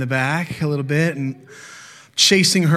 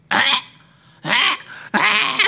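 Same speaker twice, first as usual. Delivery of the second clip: high squealing baby cry, imitated; imitated baby scream; 9 semitones higher, much slower than usual. Dad imitating his baby son's screaming, high squeals. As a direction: mimic the baby's sudden wails, high and sharp. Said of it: "starts screaming".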